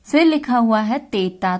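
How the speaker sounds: ordinary talk, nothing marked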